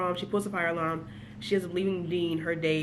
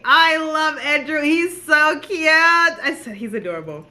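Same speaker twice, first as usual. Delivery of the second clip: high, loud, slow swooning gush; 7 semitones higher, 7 dB louder, slower than usual. Real, not acted. Fan gushing about the best-friend character, high and swooning. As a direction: melt over him like a fangirl.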